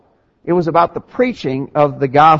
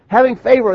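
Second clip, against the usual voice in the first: "Having" hit high and loud, falling; stressed HAVING; 7 semitones higher, 4 dB louder than usual. Real, not acted. Preacher repeating the key verse to his congregation, stressed. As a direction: lean hard on "Having favor".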